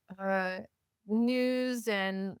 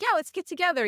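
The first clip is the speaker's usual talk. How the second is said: high, louder, casual enthusiasm; imitating a chummy crowd, pitched up; breezy group invite; 9 semitones higher, 5 dB louder, faster than usual. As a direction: toss out an easy, upbeat invitation. Imitating cliquey group's reply.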